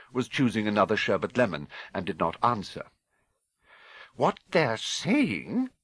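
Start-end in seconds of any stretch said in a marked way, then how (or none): 4.1-5.7 slow, high, deliberate lead-in; measured persistence, pitched up; pressing on. A narrator's reading. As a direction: insist on being heard, drawing the words out. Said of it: "she pressed on".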